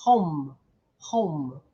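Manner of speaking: home as one syllable, silent e; say home right. Shown as a demonstration. Teacher modeling home for students, silent final e. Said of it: "I go home".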